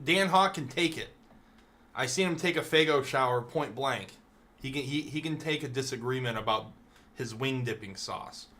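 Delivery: normal talking voice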